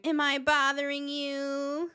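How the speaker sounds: high, snide imitation of a passive-aggressive jab; mocking imitation. Reader imitating people who needled her, high and mocking. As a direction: mimic the needling question mockingly. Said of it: "People used to say that to me all the time as, like, an insult".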